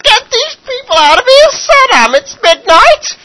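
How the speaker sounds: put-on high, loud, wailing old mother voice; nagging mother. Mother scolding Saddam at midnight, high wailing nag. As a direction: screech it out as his fed-up mum.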